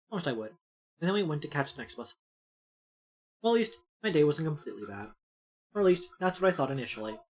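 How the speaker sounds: soft, low narrator read; quiet first-person narration voice; story narration. A narrator's reading. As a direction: read the inner monologue softly. Reading the game's narration aloud.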